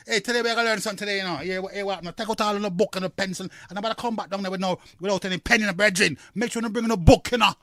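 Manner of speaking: rapid-fire Jamaican patois; quick, casual Jamaican twang, words tumbling out. Acted. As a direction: imitate fast Jamaican street talk. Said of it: "I don't hear people talking like that".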